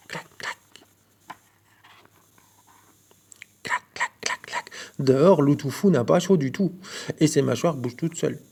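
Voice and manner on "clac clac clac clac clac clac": whispered, much softer suspense; storyteller whispering an eerie clacking. A narrator's reading. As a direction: hush down and tick the clac clac. Suspense, clock-like noise.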